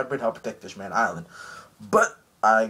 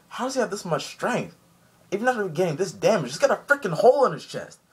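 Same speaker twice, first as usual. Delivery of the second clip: awed onlookers; 8 semitones higher than usual. Retold or imitated. Awed soldiers marvel at strength.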